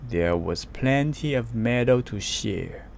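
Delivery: ordinary delivery, nothing marked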